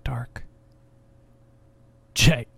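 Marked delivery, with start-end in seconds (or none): 2.1-2.5 a loud, sudden gasped cry of his name. A narrator's reading. Emma crying for Jay as she chokes, gasping. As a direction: cry out in panic. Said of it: "Emma gasped".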